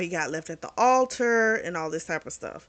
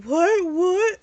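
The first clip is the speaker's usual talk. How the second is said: high, louder, rising What? What?; shocked disbelief; 10 semitones higher, 6 dB louder than usual. Retold or imitated. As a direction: squeak out the outrage of being demoted. Jada shocked at being demoted.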